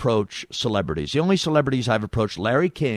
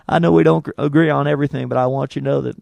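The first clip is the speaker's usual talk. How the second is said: higher, louder presidential impression; Bill Clinton; 3 semitones higher, 4 dB louder than usual. Fake Clinton wraps up call to Quayle, presidential impression. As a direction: start strong, folksy and sincere, easing down. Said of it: "call the hospital as Bill Clinton".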